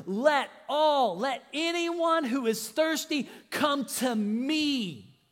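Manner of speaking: Jesus crying out to the festival crowd, loud and slow; loud proclamation. Acted. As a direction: cry it out to the whole crowd at the festival. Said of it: "declares with a loud voice".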